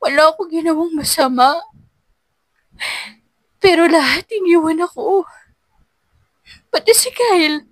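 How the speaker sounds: high, tearful complaint; crying to her mother; sobbing. A narrator's reading. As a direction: sob it out to mother. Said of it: "humikbi si Angela".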